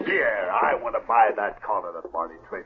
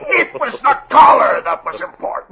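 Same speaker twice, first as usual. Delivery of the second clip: high, loud exasperated yell; frustrated shout; 9 dB louder than usual. Acted. Jeb frustrated with Jules.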